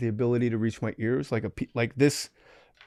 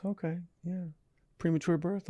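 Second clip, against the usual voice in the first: higher, softer Australian healer voice; Australian accent; 5 semitones higher, 9 dB quieter than usual. Retold or imitated. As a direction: quiz him in a soft Australian lilt. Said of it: "he's Australian".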